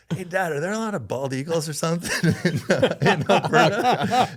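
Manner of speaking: high, loud laughing question. Retold or imitated. Naive question, laughing at memory.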